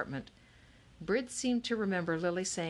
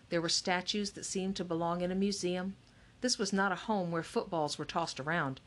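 ordinary delivery, nothing marked

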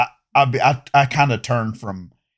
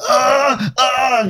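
1 mock victim groans; 13 semitones higher than usual. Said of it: "they start".

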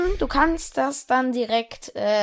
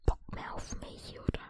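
voiceless puzzled whisper; whispered aside; 12 dB quieter than usual. Acted. Teacher whispering to himself after the student walks off. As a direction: breathe it out to yourself, baffled.

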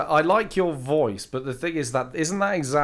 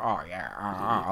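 imitating his chav voice; 6 semitones lower, 6 dB quieter than usual. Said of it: "I do like the voice".

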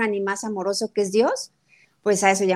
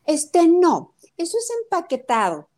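higher, a daughter rejecting packaged snacks; imitating her daughter; 7 semitones higher than usual. Mother imitating her daughter turning down packaged food, higher. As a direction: play the picky daughter refusing the food. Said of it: "Imagínate a mi hija".

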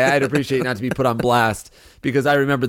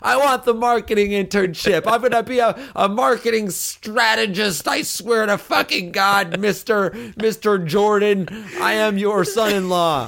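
high, slowed-down, hammed-up pleading; desperate boyfriend; 8 semitones higher than usual. Guy swearing to the girlfriend's father, high hammed-up pleading. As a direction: swear it to her father, over the top. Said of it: "You're like".

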